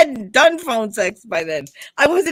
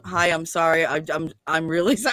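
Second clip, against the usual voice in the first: sheepish apology; 7 semitones lower, 5 dB quieter than usual. Retold or imitated. Caller's repeated embarrassed apologies.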